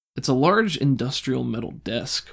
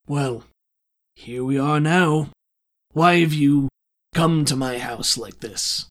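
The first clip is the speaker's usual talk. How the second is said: weary, louder, condescending villain; a bored crime lord toying with intruders; villain Strickland; 2 semitones higher, 5 dB louder than usual. Acted. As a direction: crime lord addressing party who broke in, louder and weary.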